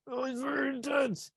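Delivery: high, soft, thin-voiced fretting; exaggerated worried squeak, kept soft; mock distress. Shown as a demonstration. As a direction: fret in a high, hushed voice. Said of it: "Like, not".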